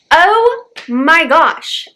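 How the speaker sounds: high, loud, slow drawn-out exclamation; overwhelmed gasp. Real, not acted. Overwhelmed reaction to the scene.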